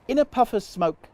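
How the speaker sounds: usual speaking voice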